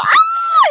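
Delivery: high, loud scream of fright, re-enacted. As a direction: let out a sudden piercing shriek. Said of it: "Jag bara börjar skrika". (återgivet skräckskrik)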